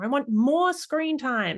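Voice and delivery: put-on high, drawn-out childish whine; a child's slow high plea; whiny child. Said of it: "the child might say".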